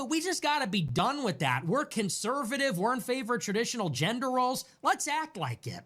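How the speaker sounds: normal talking voice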